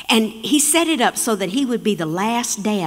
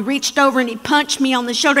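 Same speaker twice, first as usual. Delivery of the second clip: normal talking voice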